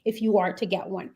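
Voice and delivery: ordinary talk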